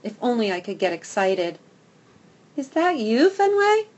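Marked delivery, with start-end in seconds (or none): 2.6-3.9 high, sweet call across the fence; lovely calling voice. Acted. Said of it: "Patches's lovely voice calls".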